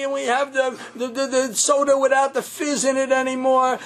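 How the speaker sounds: put-on a kid crying out to his mother, complaining; child screaming. Child yelling to mommy about the stale Friday food, whining. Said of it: "he hears one of the kids screaming".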